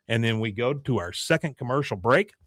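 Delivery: normal talking voice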